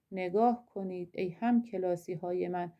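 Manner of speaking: normal talking voice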